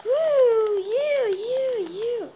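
high, loud whoop fading to pointing you you you; gleeful high whoop then counting off; excited cheer. Real, not acted. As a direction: cheer and point them out, dropping off. Cheering at game success.

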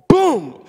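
big mock crash sound; loud boom. The speaker mimics his son falling on the ice, loud boom. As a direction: slam it like a fall.

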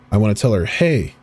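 ordinary delivery

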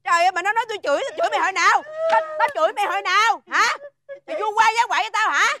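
high-pitched indignant scolding; worked-up, high complaining; exasperated protest. Acted. Exasperated, denies having scolded.

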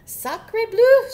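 very loud, high French exclamation; over-the-top French duck outburst; French duck's cry. A narrator's reading. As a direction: shriek it in a hammy French accent. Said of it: "she cried".

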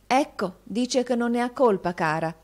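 con malizia velenosa, appena accennata; malvagiamente. Acted. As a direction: punzecchia con cattiveria, tono alto. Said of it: "osservò malvagiamente". (beffarda e maligna, ma leggera)

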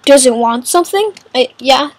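ordinary talk

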